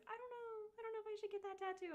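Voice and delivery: high, fast, fretful mom voice; mimicking mom. Daughter mimics her panicky mom, high and fast. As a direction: squeak out her panicked mom's worry. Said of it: "She's like".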